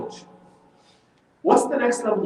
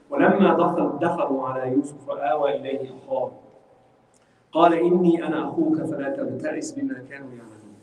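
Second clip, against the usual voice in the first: measured Arabic recitation, lower than the talk; Quran recitation; 3 semitones lower, much faster than usual. Acted. Teacher recites the verse to the class, reciting. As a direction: recite the verse in a low, even chant.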